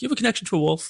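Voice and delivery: high-pitched mock psychic's question; incredulous question. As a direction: put on a high voice and ask in disbelief. Imagined psychic asking a question.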